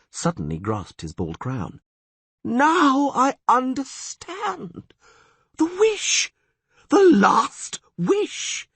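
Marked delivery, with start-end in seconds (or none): 2.4-8.7 high, loud, dawning cry of shock; slow, shaken gasp as it all clicks; sudden realisation. Acted.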